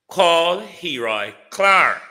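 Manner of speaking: loud, slow, high bellow; calm turning angry. Acted. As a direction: boom the name out, drawn out. I'm going to stay calm tonight.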